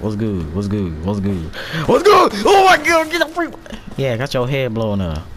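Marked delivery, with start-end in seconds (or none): 1.9-3.6 loud, high, startled yelling; panicked yell. Real, not acted. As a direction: scream it as the enemy jumps you.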